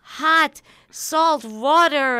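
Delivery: put-on high, loud, clumsy Yiddish accent; bad Yiddish accent. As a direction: overdo a terrible Yiddish accent, shrill. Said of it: "Diane Keaton's Yiddish accent is terrible".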